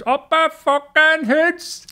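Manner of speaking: high, loud, drawn-out crowd chant shout; heckler's yell. Retold or imitated. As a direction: bellow it across a festival crowd, hammed up. Heckler yelling between songs.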